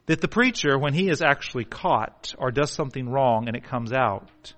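ordinary delivery

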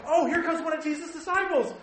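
high, giddy put-on angel chatter; excited angels. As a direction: bubble over with imitated excitement. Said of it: "how much excitement do you think these angels have".

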